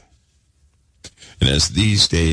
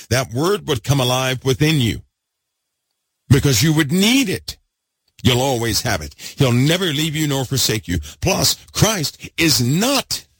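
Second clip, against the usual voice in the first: excited preaching; 6 semitones higher than usual. Real, not acted. Preacher to congregation, getting excited about the Word. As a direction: pitch climbing higher as the fervour builds. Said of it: "Let me calm down. It's too late to get excited".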